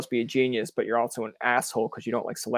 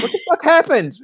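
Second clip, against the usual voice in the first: high, loud incredulous outburst; mock outrage; 9 dB louder than usual. Host imagining a year-end catch-up, mock-outraged yell. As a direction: blurt it out in exaggerated disbelief. Acted reaction to abandoned plans.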